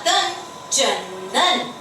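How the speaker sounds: Chandan drawn out syllable by syllable; slow sounding out. Shown as a demonstration. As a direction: build Chandan slowly. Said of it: "चन".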